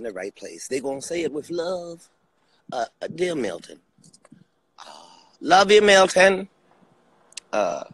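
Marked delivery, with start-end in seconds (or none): 3.1-6.5 complimentary tone. Acted. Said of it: "in a complimentary tone".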